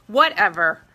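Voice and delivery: dismissive whatever. Real, not acted. Brushing off looking yellow.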